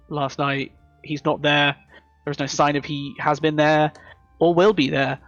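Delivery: ordinary talk, nothing marked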